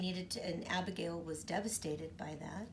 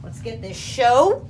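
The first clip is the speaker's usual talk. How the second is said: loud rising kickoff; excited hype; 2 semitones higher, 16 dB louder than usual. Real, not acted. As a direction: launch the game with energy. Pumped start.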